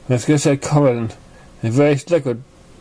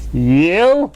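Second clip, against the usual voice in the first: a high, louder, startled "you?"; shocked yell; 6 semitones higher, 4 dB louder than usual. Acted. Trixie recognises Pinkie Pie, a high, louder, startled shout. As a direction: jolt up in disbelief. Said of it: "the son yelled".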